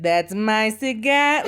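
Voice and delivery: put-on slow Brooklyn newsboy accent. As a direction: play it like a Brooklyn newsie. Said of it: "we're talking in full Brooklyn accents".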